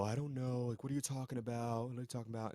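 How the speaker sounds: lightly voiced innocent act; husband dodging wife's question, mock clueless; playing dumb. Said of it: "he tries to play the fool".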